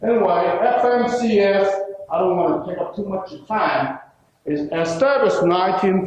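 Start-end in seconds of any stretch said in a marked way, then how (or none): none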